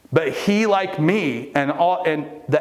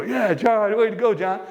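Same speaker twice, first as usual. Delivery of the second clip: mock cheer; 8 semitones higher than usual. Imitating group cheering John.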